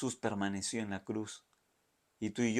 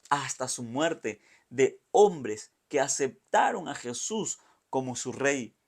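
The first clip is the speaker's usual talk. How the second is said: louder, higher preaching push; fervent preaching; 3 semitones higher, 8 dB louder than usual. Acted. Preacher stirs the church about Simon, fervent preaching. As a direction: drive the point home to the congregation.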